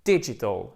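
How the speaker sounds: "digital" as DIH-ji-tl, falling; English model "digital" with a weak ending; first-syllable stress. Shown as a demonstration. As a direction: punch the short first i of "digital". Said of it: "accent tonique en première position".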